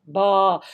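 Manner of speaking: ba low and level. Shown as a demonstration. 低声 ba. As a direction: drop ba down low. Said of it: "They have a low sound".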